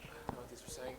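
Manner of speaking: breathy aside whisper. Real, not acted. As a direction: whisper it under the noise. Aside during chaotic wrap-up.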